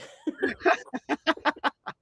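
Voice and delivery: high delighted cackle; high laugh. Real, not acted. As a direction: burst of high-pitched laughter. Laughs at cocktail offer.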